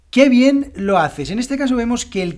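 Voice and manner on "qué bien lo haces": "Qué" hit hard, loud and slow; stressed qué. Shown as a demonstration. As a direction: stretch out "Qué bien lo haces", punching "Qué". ("Qué" tónico, enfático y lento)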